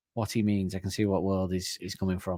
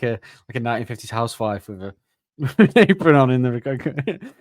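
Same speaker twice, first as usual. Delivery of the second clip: ordinary talk, nothing marked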